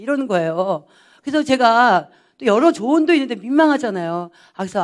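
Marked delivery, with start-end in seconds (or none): none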